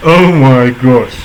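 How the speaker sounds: slow, drawn-out amazed exclamation; stunned oh my gosh. Real, not acted. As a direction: let the shock stretch the words out. Reacting to the diss line.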